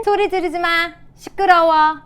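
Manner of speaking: high, loud mock telling-off; annoyed parent. Shown as a demonstration. Parent to a yelling child, acted annoyance. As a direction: act a parent snapping at noise. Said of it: "화를 내지 마시고 평정심을 유지해야".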